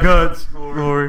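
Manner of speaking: loud, slow booming truck ad voice; loud Sam Elliott. Retold or imitated. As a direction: boom it out, drawn out. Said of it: "That was really loud".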